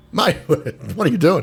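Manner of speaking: high, disbelieving laugh-laced question; incredulous mate. Retold or imitated. Telling the customers off in a retold story, high and incredulous. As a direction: pitch it up in amused disbelief. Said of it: "I'm going".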